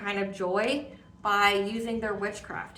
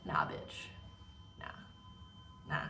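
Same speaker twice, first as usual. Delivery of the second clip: dismissive ancestors; 17 dB quieter than usual. Acted. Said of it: "your ancestors are here, like".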